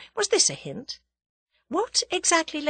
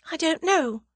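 plain reading voice

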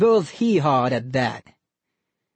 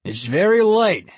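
groaning dad; 5 semitones higher, 5 dB louder than usual. Acted. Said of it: "Mister Harding groaned".

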